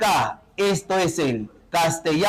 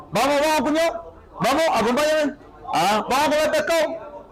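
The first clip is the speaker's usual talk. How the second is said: higher, hurried riverside Spanish with dropped consonants; riverside accent; 6 semitones higher, faster than usual. Acted. Riverside local inviting brother-in-law to eat fish, higher riverside accent. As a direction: rattle it off in a riverside accent, swallowing s and d. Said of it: "hablan muy rápido ellos".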